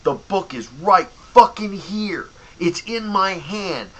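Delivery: exasperated shout. Real, not acted. Clerk yelling at cheap customers, exasperated and loud. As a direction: hammer it home, fed up. Angry rant climax.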